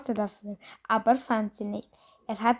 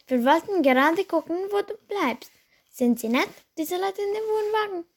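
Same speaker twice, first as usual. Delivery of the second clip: higher, quick mother voice, prying; mother fishing for gossip about the neighbours; curious mother; 7 semitones higher than usual. Acted. Mother quizzes Ben about the caravan people, higher and quick. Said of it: "Bens Mutter (scene)".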